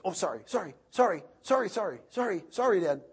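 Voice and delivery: put-on high, quick string of sorries from a little boy; imitating his son. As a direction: parrot a nervous child's apologies. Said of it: "he goes, he says this".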